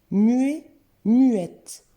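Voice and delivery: "Muet" with silent t, "muette" with sounded t; loud, clear "Muet", then "muette" ending on t; teacher contrasting "Muet" and "muette" by the final t; changed pronunciation. Shown as a demonstration. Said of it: "ça change la prononciation".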